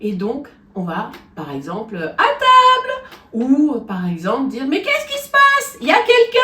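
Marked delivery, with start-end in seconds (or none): 2.0-2.9 called out high and loud in head voice. Shown as a demonstration. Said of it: "Tout ça, c'est une voix de tête". 4.7-6.4 high, loud head-voice call. Shown as a demonstration. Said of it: "Tout ça, c'est une voix de tête".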